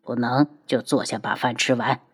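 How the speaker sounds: put-on low, soft elderly command; quiet firm old master; old man voice. A narrator's reading. (低沉老者声)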